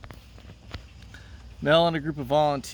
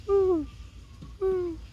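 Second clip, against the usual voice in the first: much higher whoops, done alone; lone crowd cheer. Pretend. Teacher cheering alone in place of the class, high whoops. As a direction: cheer for the whole class by yourself. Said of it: "it's just me".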